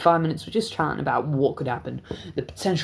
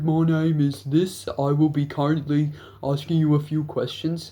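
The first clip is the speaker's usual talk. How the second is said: mimicking the officer's formal introduction to the boys; imitating a cop; 3 semitones higher than usual. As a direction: do the stiff cop introducing himself. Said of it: "He's like".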